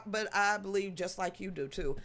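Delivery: ordinary talk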